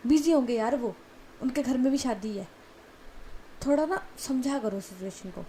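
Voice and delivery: loud, exasperated scolding; angry outburst. Acted. Brother loudly scolds his panicking sister before the wedding, angry. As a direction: shout her down, fed up with her panic.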